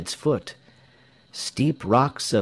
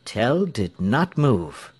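ordinary delivery

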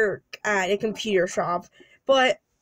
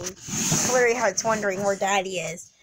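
plain reading voice

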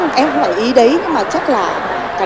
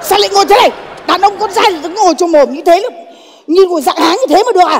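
loud, higher scolding; 6 semitones higher, 6 dB louder than usual. Acted. Woman scolding the man for how he sits, loud. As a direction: tell him off loudly. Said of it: "Sao thì cứ quát con thế em".

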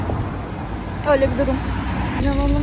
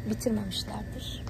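hushed whisper; 2 semitones lower, 11 dB quieter than usual. Real, not acted. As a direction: whisper it to yourself. Whispered aside in the shop.

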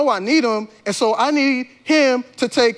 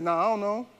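soft, casual brush-off; offhand denial; 5 dB quieter than usual. Acted. Peter to townspeople asking about his boy, offhand denial. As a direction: brush them off quietly.

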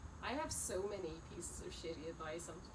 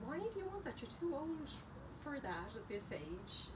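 higher, scolding mother voice; scolding mom; 7 semitones higher than usual. Retold or imitated. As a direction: play the mom telling her off. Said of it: "And my mom was like".

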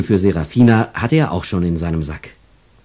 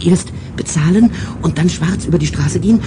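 confused angel; 8 semitones higher than usual. Acted.

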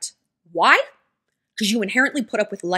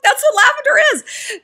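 much higher, louder mock exasperation; high, louder comic insistence; exasperated squeal; 6 dB louder than usual. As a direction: squeal the obvious fact.

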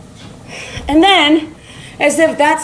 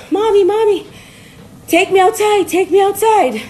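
high-pitched eager dog begging Mommy; high, insistent doggy whine for attention; pleading dog; 7 semitones higher than usual. Acted. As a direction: beg like a pet desperate to go out. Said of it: "When the dog goes".

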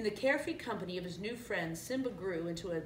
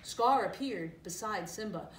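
ordinary delivery, nothing marked

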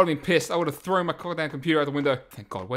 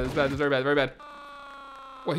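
normal talking voice

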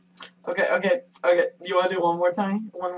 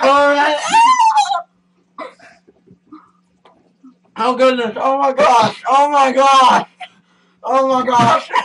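pained exclaiming; 6 semitones higher, 7 dB louder, slower than usual. Real, not acted. Reeling from the sourness.

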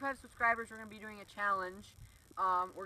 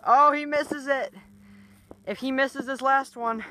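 excited; 5 semitones higher, 13 dB louder than usual. Real, not acted. Live commentary on a miss.

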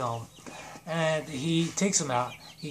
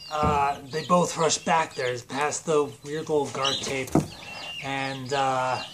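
ordinary talk, nothing marked

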